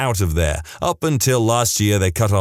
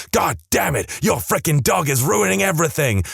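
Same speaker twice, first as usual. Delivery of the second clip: higher, mock-furious rant; retold angry outburst; 6 semitones higher than usual. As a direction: throw a fit over the shaky cake. Angry father snaps at cake.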